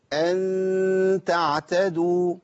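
slow recited أن تعتدوا, the nasal ghunna held long and kept light; light ikhfa with a smile. Shown as a demonstration. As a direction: stretch the ghunna of أن thin and light, a slight smile on the lips. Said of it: "يبقى".

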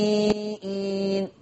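slow, soft النبيين with a clear hamza; النبيئين بإثبات الهمزة; hamza kept, Warsh. Shown as a demonstration. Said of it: "فتقرأ".

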